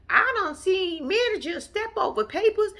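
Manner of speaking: high-pitched indignant older relative impression; mock high-voiced complaint; imitating Mama Joyce. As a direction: pitch it way up, scolding in character. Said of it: "Mama Joyce has something to say".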